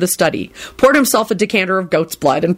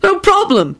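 cheerful; 13 semitones higher than usual. Acted. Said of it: "Tim replied cheerfully".

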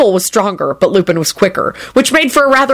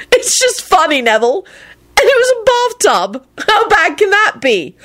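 amused apology; 13 semitones higher than usual. A narrator's reading. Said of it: "both broke out laughing".

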